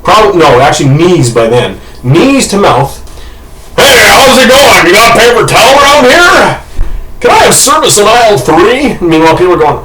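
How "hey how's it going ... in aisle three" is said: high, hailing customer call; calling out in store. Retold or imitated. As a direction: call across the shop for service.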